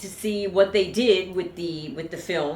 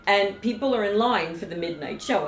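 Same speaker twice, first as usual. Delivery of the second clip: usual speaking voice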